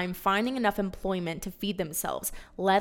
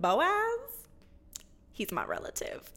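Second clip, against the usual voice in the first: high name, then a hushed whisper; conspiratorial whisper; 8 semitones higher, 4 dB quieter, slower than usual. Acted. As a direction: let Ruth in on a secret. Naomi hinting secretly to Ruth.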